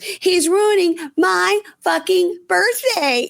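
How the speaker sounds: high, drawn-out whiny tantrum; hammed-up reality-show meltdown; Stassi meltdown. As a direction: wail it like a spoiled birthday girl, slowly. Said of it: "then she pulls a Stassi".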